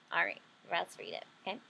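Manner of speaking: normal talking voice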